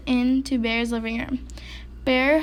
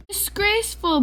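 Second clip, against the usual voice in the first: barked; 8 semitones higher than usual. A narrator's reading. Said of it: "barked Bear".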